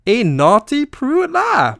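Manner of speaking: playful mock outrage; mock scandalised joke. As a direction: tease her in a shocked, sing-song voice. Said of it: "I'm joking, guys".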